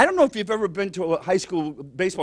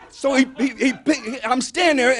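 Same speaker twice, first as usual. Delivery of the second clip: normal talking voice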